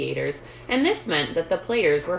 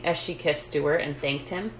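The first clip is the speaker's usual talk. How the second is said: usual speaking voice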